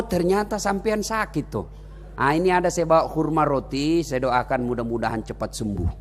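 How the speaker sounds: softly comforting the man who spat on him; gentle caring voice. Acted. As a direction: speak gently as to someone ill. Prophet visits the sick man.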